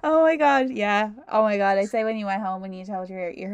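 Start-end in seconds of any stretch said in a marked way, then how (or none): none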